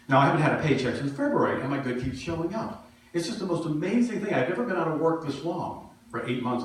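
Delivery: usual speaking voice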